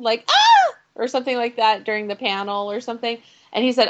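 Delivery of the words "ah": short, loud, very high squeal; squealy shriek. Retold or imitated. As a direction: imitate your own fangirl squeal. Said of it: "I made a squealy sound".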